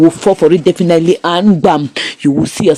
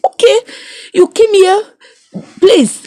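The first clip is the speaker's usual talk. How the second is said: very high-pitched mock voice; imitated friend; 13 semitones higher than usual. Storyteller quotes the happy man to his friend, high mock voice. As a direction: quote him in a small, high put-on voice. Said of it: "This guy now was like".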